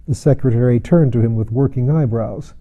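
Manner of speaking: plain reading voice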